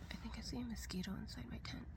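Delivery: fast breathy whisper. Real, not acted. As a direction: whisper it quickly, a bit longer. Whispering in tent at night.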